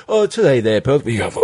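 garbled Australian with acid reflux; heartburn Australian accent. Shown as a demonstration. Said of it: "or heartburn Australian".

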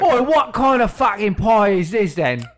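slow, indignant British customer; mock British accent. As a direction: stretch it in hammed-up British. Riff as angry British customer.

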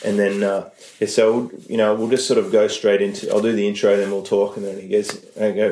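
ordinary talk

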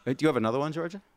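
normal talking voice

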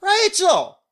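very high, loud call across four lanes; calling across the street. Retold or imitated. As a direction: yell the name across traffic. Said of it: "So she goes".

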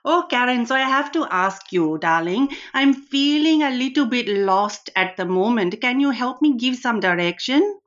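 a hammed-up Indian auntie accent, full of darlings; Rani's Indian accent. Host plays Rani greeting Karen, in a playful put-on Indian accent. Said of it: "I've got Rani sitting next to me".

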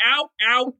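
snob ordering someone out, rising; hammed-up dismissal; mock banishing order. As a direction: throw them out with mock outrage. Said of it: "and they're like".

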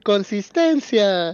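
slow, drawn-out proclamation; a slow, showy call of the word; mock sign announcement. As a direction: stretch the word out like a sign girl calling it. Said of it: "sale Lola cargando un letrero".

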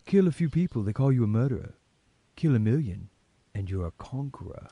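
lightly voiced villain, pompous and philosophical; mock-deep Quaylen. Host reading Quaylen's line as a pompous philosopher. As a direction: deliver it like faux-profound wisdom. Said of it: "just Eric Quaylen trying to be deep and philosophical".

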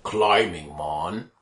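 slow, low monster growl; a rock beast rumbling its name; barbarian. Acted. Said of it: "then you really hear".

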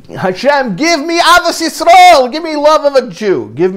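imitating a wailed prayer, high and loud, then subsiding; retold crying plea. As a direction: cry out to God, pitch soaring, easing at the end. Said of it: "he started crying and said".

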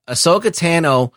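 normal talking voice

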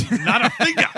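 hammed-up loud protest. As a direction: punch it up a second time.

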